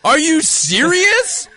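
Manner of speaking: high, loud, drawn-out indignation; outraged disbelief. Acted. Man shut out of Wonder Woman showing, comic outrage. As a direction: erupt in hammed-up disbelief. Comic rant about women-only screening.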